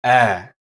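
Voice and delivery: "Ä" as the vowel of ten, held longer. Shown as a demonstration. Teacher demonstrates the umlaut, "Ä" as a held eh. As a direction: say the eh of men and stretch it into "Ä". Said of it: "Just hold it for a second longer".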